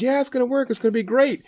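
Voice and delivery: high-pitched, confident reassurance, imitating a neighbor; put-on voice of an assured helper; upbeat neighbor voice.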